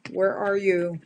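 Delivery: ordinary delivery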